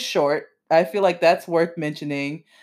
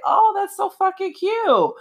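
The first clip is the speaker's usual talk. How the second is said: much higher, squealy delight; gushing; 12 semitones higher than usual. Retold or imitated. Reacting to the couple growing together, a high gush. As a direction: gush over the couple like a fan. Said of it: "And I was like".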